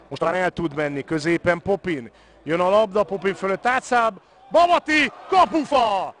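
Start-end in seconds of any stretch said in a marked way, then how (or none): 2.4-6.1 rising to a near shout on the post; calls the chance with mounting excitement; excited commentary. Real, not acted. Near miss hits the post.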